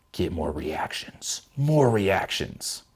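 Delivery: slow, drawn-out mock chant; mocking drawl. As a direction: stretch it out, mocking your old self. Drawn-out mocking of old goal.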